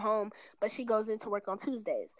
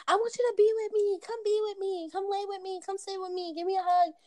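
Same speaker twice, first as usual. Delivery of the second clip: high, pleading little voice, imitating herself; needy clingy plea; 10 semitones higher than usual. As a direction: beg him to stay close, in a much higher voice. Clingy plea to boyfriend, retold.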